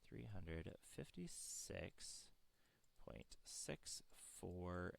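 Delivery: slow, each number spelled out; slow dictation. Shown as a demonstration. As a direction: read it slowly for notes. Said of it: "repeats answer slowly for writing".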